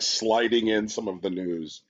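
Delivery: ordinary talk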